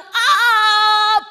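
long, loud, high-pitched "aah"; imitated scream. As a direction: re-enact the scream at full volume. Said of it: "Tunanganmu telepon Tante dan bilang". (menirukan jeritan keras dan panjang)

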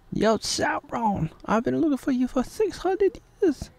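high, soft falsetto dub; hammed-up high woman's voice; mock woman's voice. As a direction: squeak it like a silly fake lady. Dubbing a woman character.

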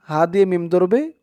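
ordinary delivery

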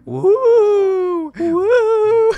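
high drawn-out ghost "woo"; ghostly wooing. Pretend. Prankster playing ghost to spook Ashley, high spooky wooing. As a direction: wail like a playful pretend ghost.

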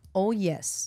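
"Oh, yes" said with a careful English accent; teaching the sister to say "Oh, yes" in English; English "Oh, yes" demo. Shown as a demonstration. A host teaching her sister a bedroom phrase, in an English accent. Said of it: "repite conmigo".

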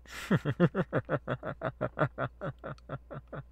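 high, drawn-out villain cackle; evil cackle. Acted. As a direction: laugh like a gloating cultist. Villain laugh after threat.

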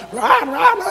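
high-pitched "rah rah" crowd noise; squawked mock babble of loud diners; mock yelling. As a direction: imitate noisy restaurant chatter. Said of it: "everybody seems like they're yelling".

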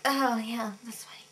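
hushed, breathy aside; whispered. Retold or imitated. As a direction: whisper it under your breath. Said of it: "but I was like".

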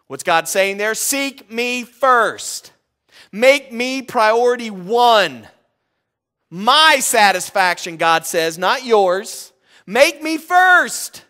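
loud, high, emphatic sermon delivery; fiery preaching. Acted. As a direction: drive each word home to the congregation. Preacher exhorts congregation.

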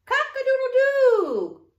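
high, loud drawn-out crow; rooster crow. A narrator's reading. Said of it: "crowed the rooster".

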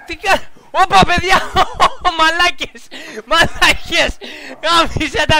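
real laughing shock; shocked outburst. Real, not acted. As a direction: yelp at what you just saw.

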